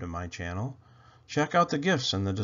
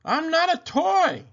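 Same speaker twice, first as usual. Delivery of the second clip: much higher, louder frantic cry; panicked protest; 6 dB louder than usual. Acted. Boy protesting on belt.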